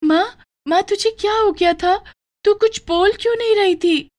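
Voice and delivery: a frightened daughter asking her mother; high and worried child voice; anxious little girl. Acted. Daughter relieved mother woke.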